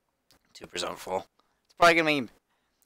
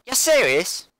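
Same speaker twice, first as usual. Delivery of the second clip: indignant put-on British accent, pitched up; 5 semitones higher than usual. Player answers the announcer's insult, indignant mock British accent. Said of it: "I should probably stop that actor".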